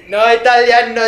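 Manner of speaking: high, loud, desperate plea; a high, loud plea on the verge of tears; near-tearful yell. Retold or imitated. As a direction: beg to be understood, almost crying. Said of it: "casi lloraba".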